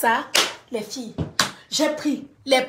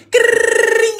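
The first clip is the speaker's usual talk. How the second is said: high rolled crrring of a ringing phone; phone ring sound effect; phone ring imitation; 9 semitones higher, 6 dB louder than usual. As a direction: mimic a phone bell, high and loud.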